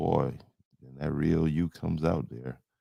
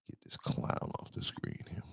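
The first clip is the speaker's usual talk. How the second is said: whispered aside; 16 dB quieter than usual. Real, not acted. Streamer whispering to remove a viewer, soft whisper. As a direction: mutter it off-mic.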